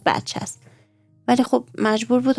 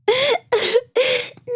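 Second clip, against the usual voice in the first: one high wailing sob; 14 semitones higher than usual. Acted. Maryam breaking down in front of her father, high sob. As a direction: burst into a high childish sob. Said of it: "زار زار گریه کرد".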